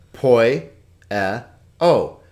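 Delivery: Poi, e, o, each sound standing alone; syllable by syllable. Shown as a demonstration. Teacher showing three vowel sounds, Poi, e, o separated. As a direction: pause after Poi and after e, before o. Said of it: "three syllables".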